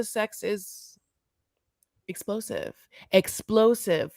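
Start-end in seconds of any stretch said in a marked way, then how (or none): 0.0-2.7 much softer, almost under the breath; quiet, held-back delivery; hushed understatement. Shown as a demonstration. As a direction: drop the word explosive right down. Same word repeated for effect.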